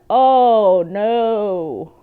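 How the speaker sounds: loud, drawn-out mock dismay; retold horror. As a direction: cry out in acted dread. Acting out dread at baby spiders.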